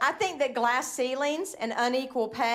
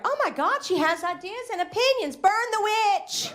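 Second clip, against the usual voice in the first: mock outraged crowd; 7 semitones higher than usual. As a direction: shriek it like a scandalized villager. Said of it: "They're like".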